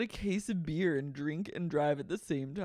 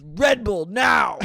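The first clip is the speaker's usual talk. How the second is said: slow, loud barked order; demanding shout; 5 semitones higher, 14 dB louder, much slower than usual. Retold or imitated. Kristen demanding a Red Bull, shouting. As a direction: bark the order out.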